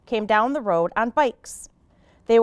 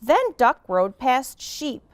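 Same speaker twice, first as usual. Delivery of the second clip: ordinary delivery, nothing marked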